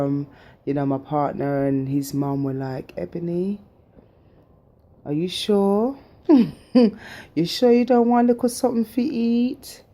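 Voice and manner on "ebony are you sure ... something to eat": family in a high Jamaican patois; Jamaican accent. Retold or imitated. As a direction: tease in a coaxing Jamaican accent. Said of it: "Me talking in my, um, Jamaican accent".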